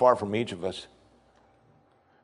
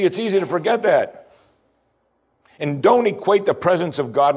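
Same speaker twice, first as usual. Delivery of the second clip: preaching at full force; 6 semitones higher than usual. Acted. Preacher urging the congregation to own God's promise, full force. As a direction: drive it home to the congregation.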